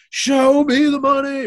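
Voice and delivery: loud, high, drawn-out mock battle cry; mock scream. Analyst imitates players screaming from the mountaintops, mock scream. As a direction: holler it like a player demanding cash. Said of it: "they scream".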